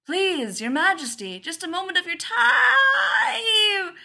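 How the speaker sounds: high, loud yell stretching into a wail; yelling plea. A narrator's reading. Man on a parapet addressing a billboard, desperate yell. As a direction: shout up a plea, drawing out the last word. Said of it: "yelling into the rain".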